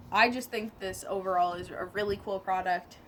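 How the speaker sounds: ordinary delivery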